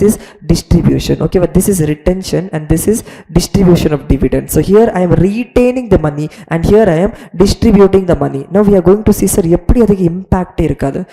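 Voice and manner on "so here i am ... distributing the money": usual speaking voice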